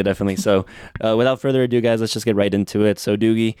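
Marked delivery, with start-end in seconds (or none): none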